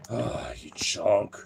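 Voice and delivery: slow whispered affection; whispered coo. Real, not acted. Owner whispering fondly to her chunky dog. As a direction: breathe it out slowly, fond.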